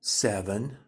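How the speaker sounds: lightly voiced small answer; childlike inner voice. Inner voice answering how old she is, a hint childlike. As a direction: say it like a child would. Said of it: "mine said".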